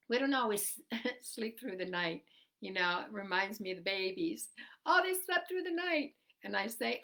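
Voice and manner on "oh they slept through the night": higher, bright proud parent; mock parent voice. Quoting parents about babies.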